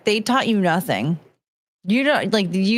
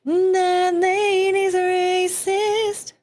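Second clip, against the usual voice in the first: Disney princess; 8 semitones higher, much slower than usual. Pretend. Repeats the song.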